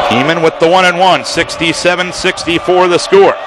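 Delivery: usual speaking voice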